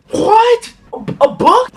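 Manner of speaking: stunned he'd been accused; student told he had a book out; incredulous cry. Retold or imitated.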